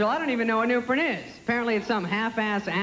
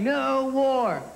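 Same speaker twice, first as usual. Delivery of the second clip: a raised, drawn-out rallying cry; mild mock yell, held long; mock singer's yell; 5 semitones higher than usual. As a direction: imitate a rock singer yelling a chant. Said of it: "lead singer starts yelling".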